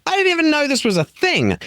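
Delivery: high mock-surprised quote; a hint of a listener's surprise; quoting a surprised listener. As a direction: lightly voice a surprised fan. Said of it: "people sign up saying".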